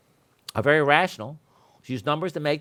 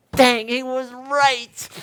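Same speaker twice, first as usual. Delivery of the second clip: slow, higher and louder grudging admission; mock grudging admission; 8 semitones higher, 5 dB louder, much slower than usual. About a code rival, slow grudging mock admission. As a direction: drag out the concession. Conceding after a code fight.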